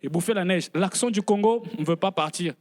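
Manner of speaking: ordinary talk, nothing marked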